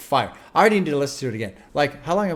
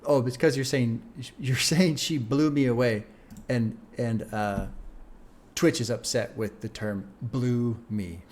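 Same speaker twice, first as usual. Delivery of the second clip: ordinary talk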